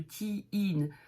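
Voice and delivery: put-on "Teen" with a long drawn ee. Shown as a demonstration. Teacher stretches the double E of "Teen". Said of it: "On prononce les deux".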